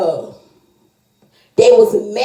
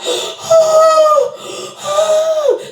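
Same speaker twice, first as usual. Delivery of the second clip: shocked gasp. Retold or imitated. Mother hears shocking news.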